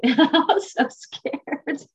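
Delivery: high, slow words through laughter; laughing recollection. Real, not acted. Laughs recalling her fear.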